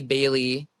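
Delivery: ordinary delivery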